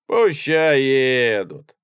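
loud, very drawn-out permission; patronising. A narrator's reading. Handsome young soldier intervenes in the quarrel, patronisingly. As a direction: grant it lordly, stretching the words. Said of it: "покровительственно сказал он".

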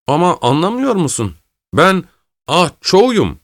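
pleading to be understood; insistent. A narrator's reading. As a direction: press the point, hitting each phrase. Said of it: "diye üsteledi öteki".

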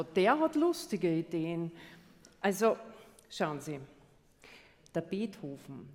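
usual speaking voice

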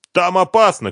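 high, loud cry of alarm; shouted warning. A narrator's reading. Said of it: "крикнул ему Машков".

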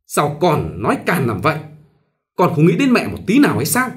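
a mother snapping at her daughter, mildly; scolding. Acted. Mother scolding her daughter for rudeness, mild sharp scold. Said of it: "Mẹ Minh gắt".

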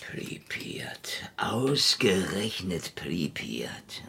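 slow, breathless whisper of dread; whispered disbelief. Acted. Marlow whispering to himself about the trip to Pripyat. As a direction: whisper the town name like a bad omen.

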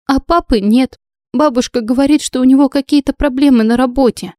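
plain reading voice

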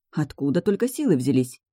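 usual speaking voice

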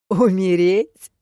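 loud, drawn-out incredulous question; scoffing, amused. A narrator's reading. Demiurge laughs off the girl's fear, loudly scoffing. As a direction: throw the word back mockingly, rising. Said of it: "хохотнул создатель Ордена Крона".

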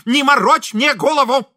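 high, loud scolding; woman shouting. A narrator's reading. Wife telling off her husband from another room, shouting. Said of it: "закричал женский голос из комнаты".

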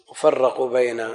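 ordinary talk

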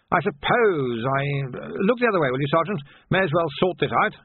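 put-on an awkward old captain stumbling into a request; embarrassed old captain. Old captain asking the sergeant to look away, embarrassed.

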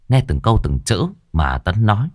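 usual speaking voice

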